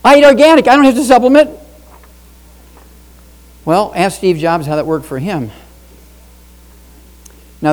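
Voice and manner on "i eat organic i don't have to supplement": high, loud snooty know-it-all voice; mock smug skeptic. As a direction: parody a smug health nut. Mocking a smug organic eater.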